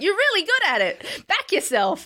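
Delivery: high, emphatic pep talk; earnest encouragement. Retold or imitated. Telling her comedy hero to back herself, high and emphatic. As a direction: push her to believe in herself. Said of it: "And I was like".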